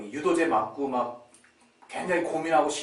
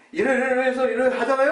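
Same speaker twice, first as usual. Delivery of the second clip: arguing son; 9 semitones higher, 5 dB louder than usual. Retold or imitated. Son arguing reasonably with father.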